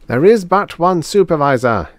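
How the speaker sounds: loud, high liturgical declaration; robot priest leading a litany; intoned. A narrator's reading. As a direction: intone it like a creed. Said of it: "intoned two oh four".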